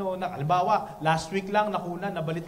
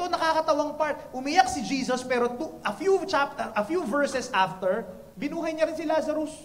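ordinary talk

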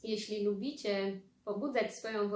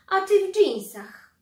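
much higher and far louder, punching ty; shocked aunt; 10 semitones higher, 12 dB louder than usual. Acted. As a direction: gasp in outrage at the jeans. Ciotka łaje wujka. (oburzona ciotka wytyka dżinsy, mocno na „ty”)

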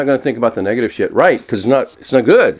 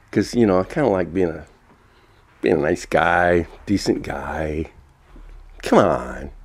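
soft, low gentle voice, a touch of mock sweetness; loving being of light voice; 5 semitones lower, 5 dB quieter than usual. To himself, the loving being of light answers, gently low. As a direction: talk like a serene nice guy who won't rant. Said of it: "as a loving being of light".

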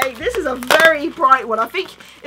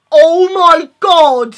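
loud, high exclamations of disbelief; real stunned excitement; ecstatic shock; 5 semitones higher, 9 dB louder than usual. Real, not acted. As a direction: cry it out, overwhelmed by the surprise. Discovers the gift is a Deluminator.

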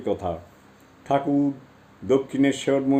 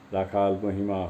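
usual speaking voice

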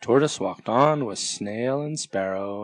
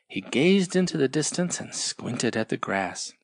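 plain reading voice

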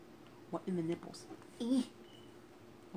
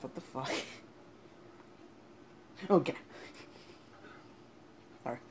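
ordinary talk, nothing marked

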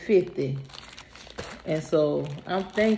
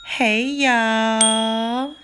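loud greeting; 5 semitones higher, 7 dB louder than usual. Real, not acted.

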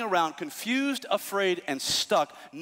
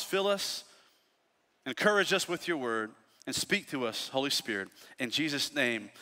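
prayer; 4 semitones lower than usual. Acted. Pastor leads a prayer.

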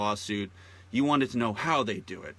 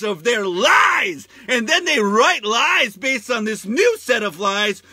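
building rant; 11 semitones higher, 8 dB louder than usual. Real, not acted. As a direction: hammer each phrase out, shouting. Ranting louder and louder.

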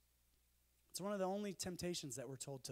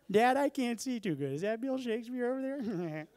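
much higher, loud, dopey comic shout; Chris Farley voice; 6 semitones higher, 12 dB louder than usual. Retold or imitated. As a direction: blurt it out like a clueless big kid. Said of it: "that's my Chris Farley impression".

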